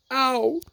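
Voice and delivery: sharp high ow; high yelp of pain. A narrator's reading. Pooh yelping while pulled out, pained yelp. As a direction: yelp as they pull. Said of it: "Pooh said only".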